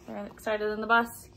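usual speaking voice

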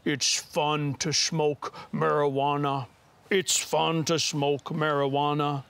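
slow, drawn-out villain voice; slowed-down record voice, testifying; Darth Vader. Retold or imitated. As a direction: intone it slowly like a backwards-played record. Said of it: "sounded now like Darth Vader".

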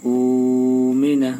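উমিনা with the first উ drawn out very slowly; madd lengthening. Shown as a demonstration. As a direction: hold the উ of উমিনা one alif long. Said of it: "এই যে প্রথমটা একালিপ টান দিতে হবে". (উমিনা প্রথম উ টেনে ধীরে)

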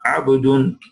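usual speaking voice

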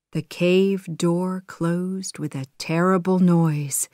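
plain reading voice

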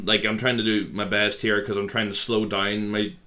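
ordinary delivery

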